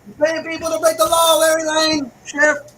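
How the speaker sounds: real angry shout at the sheriff; angry callout. Real, not acted. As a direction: call the sheriff out loud and angry. Calling out the sheriff by name.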